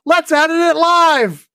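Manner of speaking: high, loud, slowed glee; gleeful reckless. Retold or imitated. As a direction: announce it with reckless delight. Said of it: "I'm just like".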